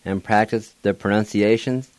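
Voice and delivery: ordinary talk, nothing marked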